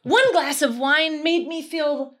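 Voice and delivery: high, loud, drawn-out delight; hammed-up giddy discovery; over-eager enthusiast. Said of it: "I'm the enthusiast".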